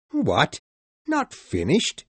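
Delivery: high, loud disbelief; shocked general. Acted. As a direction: cry out in disbelief. Sir Francis shocked.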